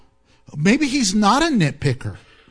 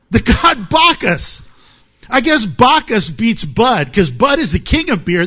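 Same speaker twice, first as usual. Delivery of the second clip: usual speaking voice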